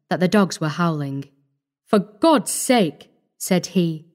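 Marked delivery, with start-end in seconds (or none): 1.8-3.1 slow, effortful, halting plea; strained plea. A narrator's reading. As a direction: force the words out with effort. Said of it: "making a great effort to arrive at speech".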